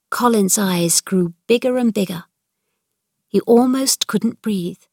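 ordinary delivery

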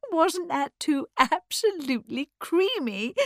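high, gushing delight; amused society lady. Acted. Norman's wife delighted by her own story, high gushing. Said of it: "joined in the laughter".

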